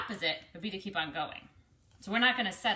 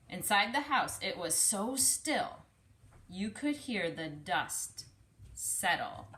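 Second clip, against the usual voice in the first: slowed down with pauses between phrases; slow, hushed reread. Shown as a demonstration. Teacher rereading slowly to let the stillness land. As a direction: linger on each phrase so the stillness sinks in. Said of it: "Let me read that sentence again".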